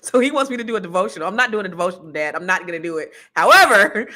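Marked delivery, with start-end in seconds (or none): 3.3-4.1 loud, drawn-out however. Real, not acted. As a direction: land the pivot hard. Comic turn, loud.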